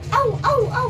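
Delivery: high loud yapping barks; dog barking. Retold or imitated. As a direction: bark like a little dog. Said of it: "kung kumakahon".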